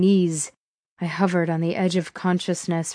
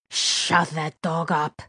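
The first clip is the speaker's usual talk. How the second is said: quiet menace; 4 dB quieter, slower than usual. A narrator's reading. Gunman annoyed by a barking dog, quiet menace. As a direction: give the order slowly, with controlled threat. Villain orders, first take quiet.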